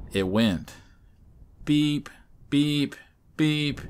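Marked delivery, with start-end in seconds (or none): 1.6-3.8 high, even imitated phone alert beeps; emergency beeps. A narrator's reading. Narrator imitating sirens heard over the radio, high beeping. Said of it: "like an emergency on the phone".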